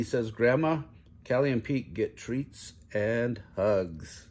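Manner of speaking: plain reading voice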